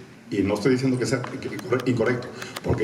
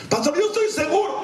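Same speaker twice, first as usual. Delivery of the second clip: much higher, insistent voice; overconfident church member. Acted. Church member assures pastor, high insistent voice. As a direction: pitch it up, utterly certain.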